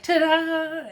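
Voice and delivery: high, loud sing-out; mock triumphant reveal. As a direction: imitate a showy unveiling. Retelling a gift reveal.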